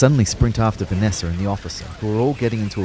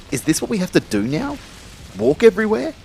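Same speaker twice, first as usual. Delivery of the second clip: incredulous complaint; 12 semitones higher, 3 dB louder than usual. Acted. Kyle dismayed at walking.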